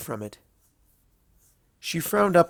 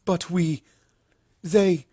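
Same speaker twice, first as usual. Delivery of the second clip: stammering; 6 semitones higher, slower than usual. Acted. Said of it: "Luna stammered".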